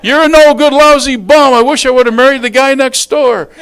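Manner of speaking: very loud, high insult tirade; acted furious shouting; lobbing verbal grenades. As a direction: hurl it full force at a spouse. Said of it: "start lobbing hand grenades".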